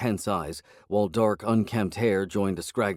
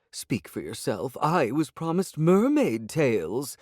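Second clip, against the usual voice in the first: slow, rising to a higher complaint; sulky cat; 7 semitones higher, slower than usual. A narrator's reading. Disappointed cat complains about mermaid tails, slow pout. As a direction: pout over the lost treat.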